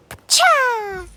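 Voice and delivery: loud, high, drawn-out "pacha"; imitated crash noise. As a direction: burst out with a crash effect. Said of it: "it was just like".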